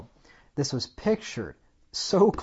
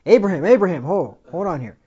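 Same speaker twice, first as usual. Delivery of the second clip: urgent angel call; 6 semitones higher, 13 dB louder than usual. Acted. Angel stops Abraham's knife.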